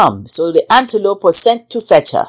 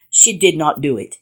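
ordinary delivery

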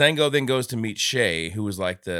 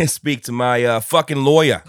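louder Brooklyn tough guy; Brooklyn accent; 2 semitones higher, 9 dB louder than usual. Shown as a demonstration. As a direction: bark it like a Brooklyn thug. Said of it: "Yeah, that would have been better".

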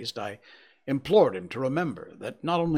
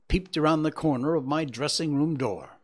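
plain reading voice

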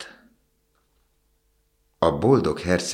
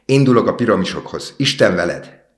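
loud, higher farewell call; cried out; 5 semitones higher, 9 dB louder than usual. A narrator's reading. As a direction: fling the goodbye out loud. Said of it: "kiáltotta a fecske".

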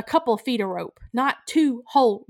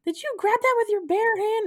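much higher incredulous question; amazed disbelief; 13 semitones higher than usual. Real, not acted. Asking Zach about the bare-handed fish, incredulous. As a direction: ask it stunned and delighted.

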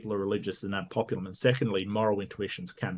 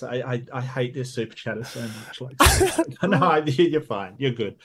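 ordinary talk, nothing marked

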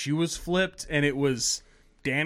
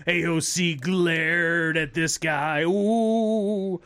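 slow, drawn-out fake gasping gossip; sarcastic ooh-ing at half speed; mock scandalized; much slower than usual. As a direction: stretch every word in pretend shock. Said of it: "everybody goes".

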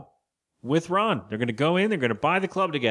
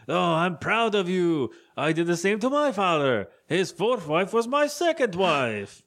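proud approval; 4 semitones higher than usual. Retold or imitated. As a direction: draw it out with mock pride. Ron acted as proudly approving.

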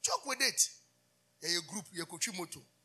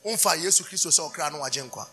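louder, lower urgent appeal; loud altar call; 5 semitones lower, 7 dB louder than usual. Acted. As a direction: beckon them to raise hands.

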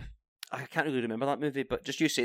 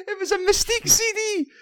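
excited; 7 dB louder than usual. Real, not acted. Punchline of a funny story.

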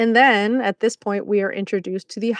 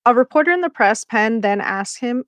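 ordinary delivery

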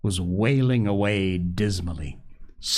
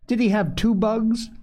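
put-on high-pitched childlike question; suspicious little girl; 11 semitones higher than usual. A narrator's reading. Small child eyeing a second bite, suspicious. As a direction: pipe it up high, mildly doubtful. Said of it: "eyeing suspiciously the second morsel".

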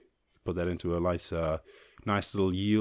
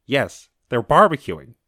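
louder, slower insisting Karen; insistent caller; 3 semitones higher, 9 dB louder, slower than usual. Retold or imitated. Caller insisting to police, mock slow loud indignation. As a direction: drag out the complaint with mock indignation. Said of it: "You're like".

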